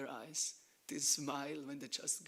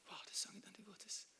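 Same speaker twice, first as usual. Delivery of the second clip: whisper, marvelling softly; awed whisper; 5 semitones higher, 9 dB quieter than usual. Retold or imitated. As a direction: hush your voice as if in wonder. Voicing Prabhupada's awe.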